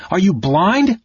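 loud, high pleading shout; high loud cry of frustration; frustrated shout. Acted. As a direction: shout at her, desperate to be heard. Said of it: "almost shaking her in his frustration".

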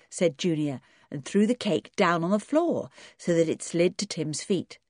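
plain reading voice

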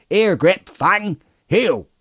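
high, sharp command to dogs; crying out. A narrator's reading. Farmer calling off his dogs, high shouted command. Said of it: "he cried".

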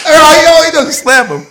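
loud, high, astonished exclamation; excited outburst. Real, not acted. Witness telling of the sudden slap, excited outburst. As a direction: burst out loudly at the climax. Climax of the fight story.

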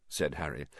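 ordinary delivery

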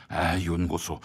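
deep, slow stern court official; low stern minister. Acted. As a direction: press him like a cold interrogator. Low rival character.

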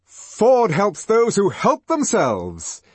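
loud, high, drawn-out rallying cry; slow, triumphant shout; exultant. A narrator's reading. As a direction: proclaim it exultantly before charging in. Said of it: "a laugh of exultation".

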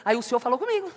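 ordinary delivery

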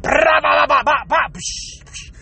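rattling burst tailing into a splash; imitated bowel eruption. Comic acting out losing it in a public bathroom, imitated eruption. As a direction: pop out blasts, then a splashy hiss. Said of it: "you can't hold it".

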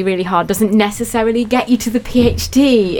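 normal talking voice

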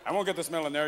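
normal talking voice

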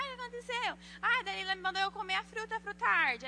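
high mock wailing complaint; imitated crying child. Friend telling on Dalila after the pepper, mock crying. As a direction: whine like a tearful girl, hammed-up. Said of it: "ela começou a chorar, foi lá chorando".

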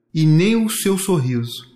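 usual speaking voice

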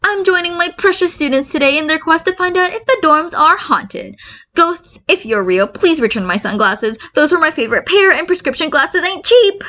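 high, showy radio-host voice; loud hero voice. Acted. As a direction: play the flashy pro hero on air. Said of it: "get his voice under control".

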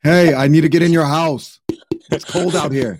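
lightly voiced New York spider at the door; New York accent. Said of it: "accent from New York City".

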